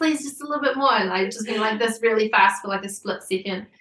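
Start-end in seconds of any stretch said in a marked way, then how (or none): 0.0-0.9 high, coaxing plea; pleading husband. Retold or imitated. Husband filming asks her to move her hand, high coaxing. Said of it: "He's like".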